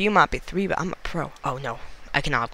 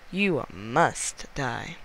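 slow drawn-out threat; much slower than usual. Acted. Banana player slowly threatening the last survivor. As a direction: stretch each word, slow and menacing.

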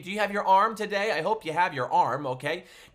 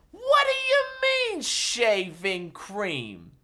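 very high squeak then slow falling shaving cream; hammed-up disbelief; 3 semitones higher, slower than usual. Entertainer hams up disbelief at Daddy's gift, highest squeal. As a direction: push the outrage to a squeal. Third take even higher.

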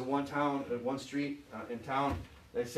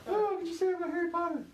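squeaky falsetto, hurried and indignant; mock offended fan; faster than usual. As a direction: lampoon a shocked fan in a high silly voice. Mocking an offended Potter fan.

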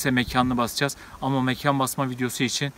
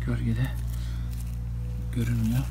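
hushed; 3 semitones lower, 10 dB quieter than usual. Real, not acted. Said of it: "Bu arada biraz sessizleşelim".

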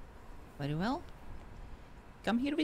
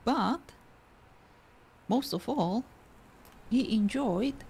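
storytelling voice; 3 semitones higher, 5 dB louder, slower than usual. Acted. Continues the made-up story.

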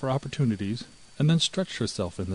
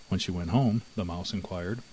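ordinary delivery

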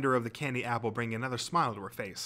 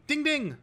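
high-pitched, loud voiced buzzer ding; 5 dB louder than usual. A narrator's reading. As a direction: chime a sudden high ding-ding. Narrator imitates the quiz buzzer.

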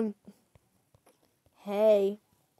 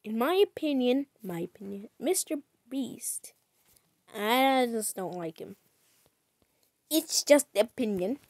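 usual speaking voice

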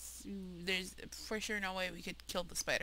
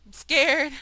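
loud frightened cry; scared; 4 semitones higher, 15 dB louder than usual. Real, not acted. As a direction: blurt out fear.

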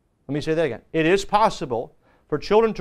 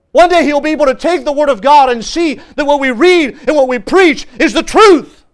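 loud, higher-pitched pulpit preaching; impassioned preaching; 5 semitones higher, 9 dB louder than usual. Real, not acted. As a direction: preach it loud, building to a ringing finish.

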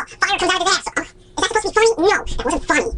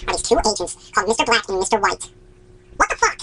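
ordinary talk, nothing marked

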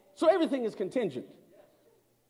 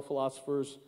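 ordinary delivery

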